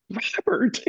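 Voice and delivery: name pitched high in wonder; starstruck awe. Retold or imitated. Musician repeats his famous friend's name, starstruck. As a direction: marvel at it, voice lifted.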